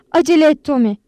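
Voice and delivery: loud, high urgent order; commanding. A narrator's reading. Anita ordering Tommy to hurry to hospital, commanding. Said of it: "diye emretti Anita".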